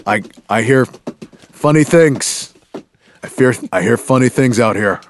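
spooky movie voice. Acted. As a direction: play it creepy and unsettled. Acting scared character from film.

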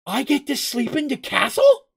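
high-pitched, astonished question; wide-eyed amazement. A narrator's reading. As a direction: ask it like an awestruck little gully. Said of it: "Mugen's eyes opened wide".

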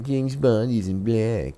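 high, mocking whine; mock whiny fanboy. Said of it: "people whining and crying".

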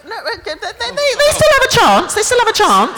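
high, laughing pleading protest. Real, not acted. Host protesting the crowd's spoilers chant, high and laughing. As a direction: squeal it over the crowd.

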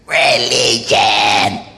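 hammed-up demonic croak. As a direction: drag it out like a possessed man. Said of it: "And the demons".

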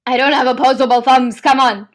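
put-on loud New York-accented cat voice; hammed-up New York accent. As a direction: protest like a brash New Yorker. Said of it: "he's from New York or something".

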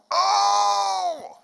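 high loud outraged Oh; sudden high shout of alarm; shocked cry. Retold or imitated. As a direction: blurt out a horrified Oh. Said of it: "and I went".

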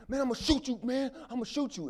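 humiliated bully shouting a death threat; furious threat. Acted. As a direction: scream it like he means to kill. Choked guy threatens to shoot.

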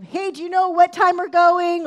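high, loud call to another room; shouting across the house. Retold or imitated. Wife to husband upstairs, shouting across the house. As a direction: holler a question through the house. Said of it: "I'm shouting to Colin across the house".

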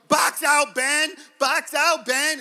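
high, loud coaching shout at his son; dad shouting the play across the court; sideline shout. Retold or imitated. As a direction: yell it from the sidelines. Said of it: "I'll be going".

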